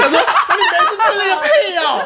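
very high mock-indignant squeal; exasperated cry, cracking up; laughing outburst. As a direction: explode in disbelief through laughter.

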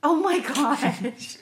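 laughing. Real, not acted. Laughing dismay.

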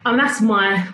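ordinary talk, nothing marked

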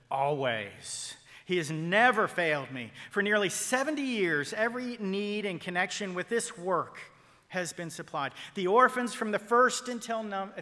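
loud, ringing testimony from the pulpit; Mueller's words, declaimed loud; emphatic testimony. Acted. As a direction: proclaim it like an old saint's witness. Preacher voicing Mueller's testimony.

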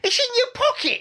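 put-on high girl's voice, laughing; the sister. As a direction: squeak it like a prim little girl. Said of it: "the sister goes".

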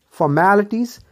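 formalities without the first r, "fo-MA-litiz"; correct pronunciation. Shown as a demonstration. Teacher drilling English words for learners, correct pronunciation. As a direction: say फॉर्मेलिटीज with a silent first r. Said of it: "not formality".

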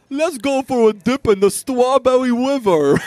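high, loud goofy creature voice, like Gru; big idiot Sasquatch. Acted. Hallucinated Sasquatch inviting his son for a dip, idiot voice. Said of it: "I sounded like fucking Gru from Despicable Me".

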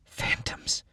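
whispered, hushed and ominous; eerie whisper. A narrator's reading. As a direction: breathe the word like a secret. Narrator names eerie creatures.

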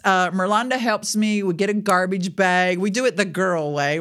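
ordinary delivery

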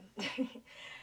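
breathy, airless nervous chuckle; soft nervous laugh under the breath. Real, not acted. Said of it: "I laugh when I'm nervous".

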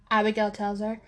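plain reading voice

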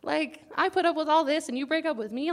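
a burst of mock outrage, pitch jumping up; indignant disbelief. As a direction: throw the question back, stunned and offended. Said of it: "and I was like".

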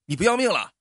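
high, loud scolding yell; angry shout. Acted. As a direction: yell at the fool in the road. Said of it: "探出脑袋骂道". (司机探出头冲路人高声怒骂)

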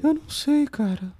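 high loud falsetto squeal; [name] voice. Retold or imitated. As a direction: squeal it high like the pop star. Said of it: "Tô imitando a voz dele só".

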